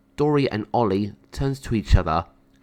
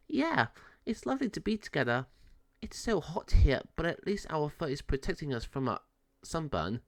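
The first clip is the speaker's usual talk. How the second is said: a higher, gentle young cat voice, only lightly put on; lightly voiced cat Shadow; 5 semitones higher, 9 dB quieter than usual. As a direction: Shadow content beside Ollie in the sun, a hint of a character voice. Shadow talking to Ollie.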